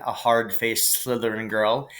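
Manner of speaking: ordinary delivery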